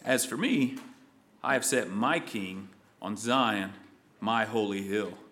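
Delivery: low, soft, solemn words of God; quietly solemn decree; hushed divine voice. Acted. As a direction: speak God's line in a low, soft voice. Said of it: "Let's see what he says".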